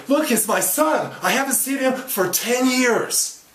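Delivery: high, loud, slow exclamation; acted elated father's cry; overjoyed outburst. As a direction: shout the news to your boss, drawing out the words. Tells boss of long-lost son.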